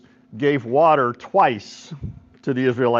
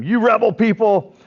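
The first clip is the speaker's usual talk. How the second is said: high, raised-voice scolding; hammed-up, exasperated outburst, pitched up; mock angry yelling; 7 semitones higher, 4 dB louder than usual. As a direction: imitate a leader yelling at his rebels. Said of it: "he screams at him, yells at him".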